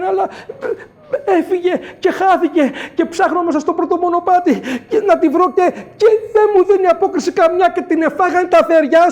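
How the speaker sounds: high, loud flustered confession; ashamed pleading. Acted. Young man confesses the quarrel to the old creator, high and pleading. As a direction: stammer out your shame and plead.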